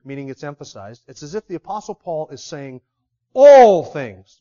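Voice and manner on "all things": "All" punched, much louder; stress on ALL. Shown as a demonstration. Preacher bolding "All things" for the congregation, loud stress. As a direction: hit the word "All" hard and bold. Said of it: "bolding it".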